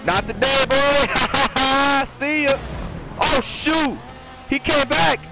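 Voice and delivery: high, excited trash talk; excited gamer yelling. Real, not acted. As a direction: blurt it out high, mid-race. Gloats and panics in a race.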